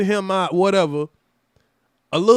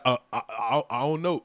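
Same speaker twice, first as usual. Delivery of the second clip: scared stammer; 7 semitones lower, 6 dB quieter than usual. Retold or imitated.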